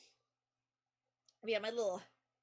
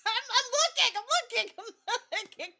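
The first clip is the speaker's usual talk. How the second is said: high, loud plea through laughter; flustered mock protest; 8 semitones higher, 5 dB louder than usual. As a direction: protest helplessly while cracking up. Flustered reply to screaming cat.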